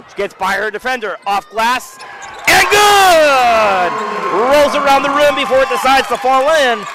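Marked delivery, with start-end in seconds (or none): 2.5-6.8 loud rising play-by-play shout; excited call. Real, not acted. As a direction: sell the basket with a big yell.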